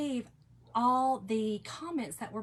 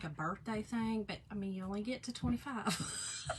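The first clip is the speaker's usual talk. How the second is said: ordinary talk, nothing marked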